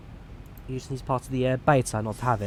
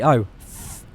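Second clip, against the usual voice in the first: normal talking voice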